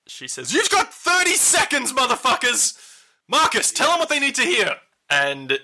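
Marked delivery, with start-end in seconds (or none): 0.5-4.8 high-pitched, strained acted shout; furious ultimatum. Villain gives the party thirty seconds, furious ultimatum. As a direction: shriek the threat up at them.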